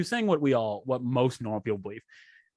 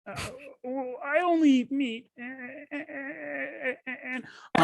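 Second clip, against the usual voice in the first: much higher, slow, whiny lecturer; a mock Peterson stuck on a word; Jordan Peterson impression; 12 semitones higher, slower than usual. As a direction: stammer it out in a strained high voice. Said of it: "your Jordan Peterson expression again".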